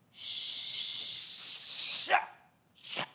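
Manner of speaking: sharp hush through teeth; shushing. Acted. Disciples shushing the blind man, sharp hush. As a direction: hush him up fast.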